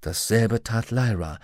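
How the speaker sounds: ordinary delivery, nothing marked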